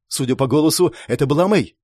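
ordinary delivery, nothing marked

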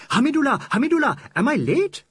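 high, fast, loud eager call; eager cry. Acted. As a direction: burst in calling his friend's name. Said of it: "he cried".

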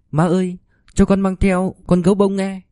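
put-on high, small child's voice; pleading little boy. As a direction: ask mom like a six-year-old. Narrator voices young son.

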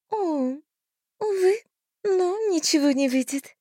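high, sighing fairy voice; a sad little fairy lament; sorrowful fairy voice. A narrator's reading. Fairy regrets.

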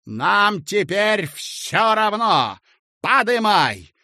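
loud, high, stretched-out swagger; devil-may-care bravado. Acted. Clerk ordering the lift up, devil-may-care bravado. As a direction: declare it with reckless swagger. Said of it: "залихватски сплюнул".

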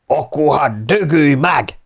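slow, high shout with some force; angry cry. A narrator's reading. Sailor cursing the officer as he attacks, crying out slowly. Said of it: "kiáltotta az egyik matróz".

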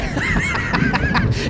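high laugh. Acted. Greets peasants, jovial.

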